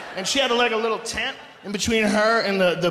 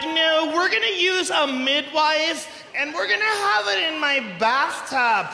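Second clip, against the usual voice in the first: higher, drawn-out mock of a natural-birth mother; mocking quote; 6 semitones higher, slower than usual. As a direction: stretch it out in mockery.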